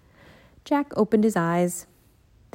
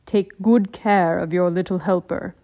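calm ninja master; 2 semitones lower, 4 dB louder than usual. Acted. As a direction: speak like a wise old teacher.

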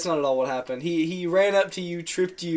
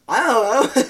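joking; 6 semitones higher, 6 dB louder than usual. Pretend. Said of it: "No, I'm kidding".

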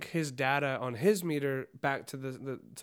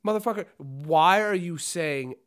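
exasperated outburst; 2 semitones higher, 6 dB louder than usual. Retold or imitated. Scolding the coworker, exasperated.

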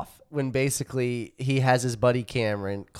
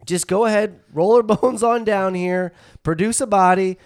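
higher, cocky voice, laughing through it; a principal smugly calling the bluff; smug mock principal; 7 semitones higher, 4 dB louder than usual. Principal mocks caller, retold.

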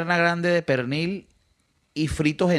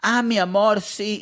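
higher, sugary mock-sweet voice; hypocritical sweetness; 8 semitones higher, slower than usual. Mother sweet-talking the waitress to her face, fake-sweet. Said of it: "se hace la hipócrita".

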